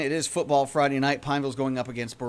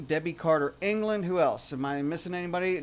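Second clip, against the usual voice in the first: usual speaking voice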